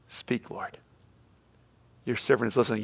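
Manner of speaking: hushed whisper. Retold or imitated. Believer whispering a surrendered prayer to God. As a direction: breathe it out as a humble prayer.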